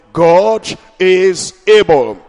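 slow, loud, stretched proclamation; drawn-out preaching. Acted. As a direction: lean on every word for the crowd. Preaching refrain.

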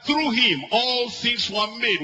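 preacher proclaiming to the congregation; preaching fervor. Acted. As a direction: lift it up in praise. Preaching at rising pitch.

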